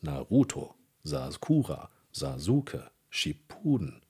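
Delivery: Germanised stress on each of Naruto, Sakura, Sasuke, Shippuden; German-style stress. Shown as a demonstration. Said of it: "diese deutsche Betonung".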